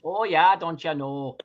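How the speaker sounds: mock Minnesotan catchphrase accent; Minnesota accent. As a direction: put on a teasing Minnesota accent. Stock Minnesotan phrase in mock accent.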